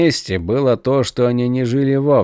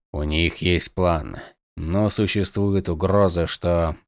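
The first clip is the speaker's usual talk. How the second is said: low dragon mage; 6 semitones lower, 5 dB quieter, slower than usual. A narrator's reading. Mage starts, then mouth fills.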